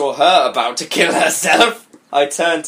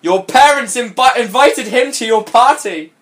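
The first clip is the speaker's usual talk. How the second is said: high, loud dramatic revelation; alarmed announcement; 7 semitones higher, 4 dB louder than usual. Acted. Friend revealing he is invited, dramatic alarm. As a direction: break bad news in a raised pitch.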